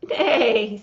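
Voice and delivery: loud neigh with a laugh; whinny it through a laugh. Acted. The horse answers from under the mask, loud laughing neigh. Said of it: "says the horse".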